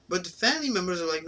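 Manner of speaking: normal talking voice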